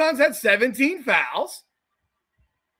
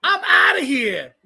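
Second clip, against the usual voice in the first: mock Gino yell; 10 semitones higher, 3 dB louder, slower than usual. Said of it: "It's like".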